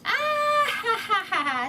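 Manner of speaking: high cackle that drops down; mocking laugh. Shepherd boy laughs at the tricked farmers, mocking. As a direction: laugh at the fooled townsfolk. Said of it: "rolling on the ground laughing".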